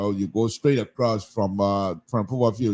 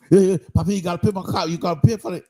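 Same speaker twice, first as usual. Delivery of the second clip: imitating keke driver; 6 semitones higher, faster than usual. Voicing the keke driver's demand.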